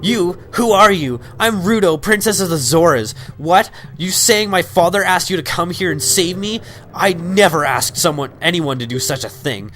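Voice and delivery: high, haughty spoiled princess voice; bratty princess. Acted. Princess Ruto snubbing Link, in a high bratty voice. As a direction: look down on the stranger like a spoiled royal. Reading princess Ruto's game dialogue.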